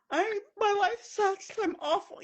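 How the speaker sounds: imitating a high, weepy wail; high-pitched imitation of tearful self-pity; mock crying. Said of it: "I'm crying".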